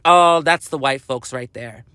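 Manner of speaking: a lightly voiced neighbor pointing someone out; imitating community folk. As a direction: drop low and say it offhand. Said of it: "then they're like".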